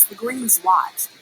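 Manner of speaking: plain reading voice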